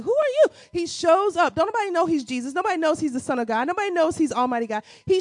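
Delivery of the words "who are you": puzzled crowd. Retold or imitated. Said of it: "People are like".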